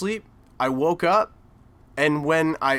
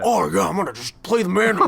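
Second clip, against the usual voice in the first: laughing put-on Cage voice, a bit higher; Nicolas Cage impression; 4 semitones higher, 3 dB louder than usual. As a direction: ham up a mock Nicolas Cage. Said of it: "You should have done Nicolas Cage's accent".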